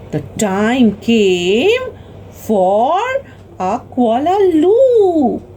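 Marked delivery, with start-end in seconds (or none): none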